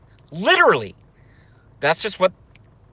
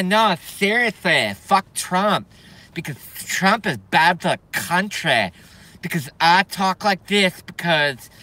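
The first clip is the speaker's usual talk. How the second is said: put-on lispy soy boy caricature; mock soy boy. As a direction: put on a drawling, lisping caricature. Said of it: "the character of the soy boy".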